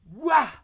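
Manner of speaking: a loud, high, sudden "Wah!"; big "Wah!". Shown as a demonstration. Teacher voicing the creature's mouth snapping open, loud high "Wah!". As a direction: throw out a big "Wah!" like a jaw springing open. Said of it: "So it goes".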